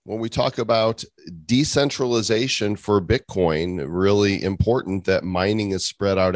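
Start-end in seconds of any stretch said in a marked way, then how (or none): none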